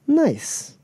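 high, falling one-word approval; impressed. Acted. Crew leader stops glaring on hearing of a feat, impressed. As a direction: pitch it way up, lightly acted.

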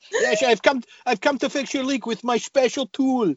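high campy porn dub voice; bad movie dubbing. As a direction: overdub it, breathy and hammy. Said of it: "Badly dubbed with seventies porn".

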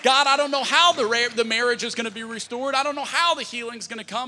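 loud, high, declaring prayer; bold faith prayer. Pretend. Believer praying through hardship, loud and bold. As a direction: declare it to God with full conviction. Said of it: "faith prayer voiced by believer".

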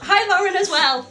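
high, loud hi; excited greeting. Real, not acted. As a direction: sing out hello. Greeting friend on air.

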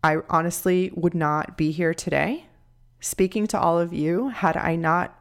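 ordinary talk

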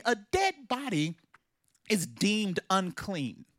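ordinary delivery

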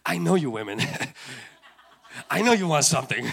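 acted, knowing laugh through the words; teasing chuckle. King teases the queen at the banquet, chuckling knowingly. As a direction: chuckle as if you see through her.

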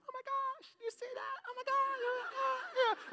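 very high, squeaky mock excited onlookers; crowd excitement. Onlookers spotting the proposal sign, mock excited squeal. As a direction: imitate thrilled women squealing in falsetto. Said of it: "everybody around us starts going like".

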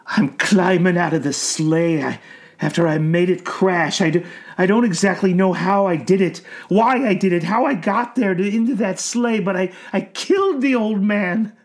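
high, strained anguish; anguished old man. A narrator's reading. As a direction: confess through anguish. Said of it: "words came out on puffs of anguished".

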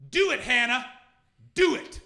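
loud, high shout of encouragement; man yelling encouragement. Retold or imitated. Man on the platform shouting at Hannah, loud encouraging yell. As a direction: bellow it like a man cheering her on. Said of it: "I just hear this man go".

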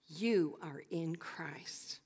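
slow hushed whisper. Acted. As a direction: let it sink in softly. Dramatic hushed point.

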